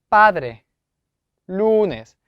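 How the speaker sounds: padre and lunes with strong, long first vowels; teacher's demo, each word alone with its first syllable stressed; stress on second-last syllable. Shown as a demonstration. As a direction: punch PA in padre and LU in lunes, then fall. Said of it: "이라고 발음이 됩니다".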